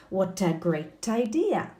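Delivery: slow "What a great idea" joined as wha-ta; linked words. Shown as a demonstration. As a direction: model the t link in What a slowly. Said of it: "on the linking".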